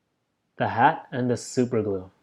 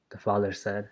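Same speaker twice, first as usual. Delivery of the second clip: ordinary delivery, nothing marked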